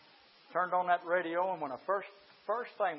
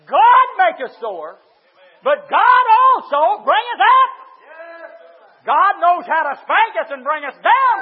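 shouted sermon, high and very loud; full-force preaching; 11 semitones higher, 22 dB louder than usual. Real, not acted. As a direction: belt it out to the whole congregation.

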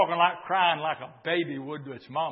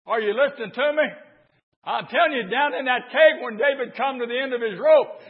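ordinary delivery

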